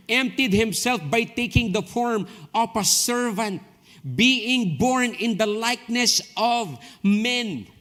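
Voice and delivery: slow, lifted, weighty Bible reading; solemn scripture read, drawn out. Acted. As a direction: read each word as sacred.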